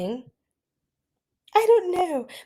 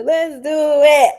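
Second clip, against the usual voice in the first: playful shout; 4 semitones higher, 8 dB louder, much slower than usual. Pretend. Shouted follow-up to the song.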